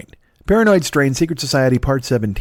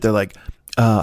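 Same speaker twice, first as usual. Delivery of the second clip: ordinary delivery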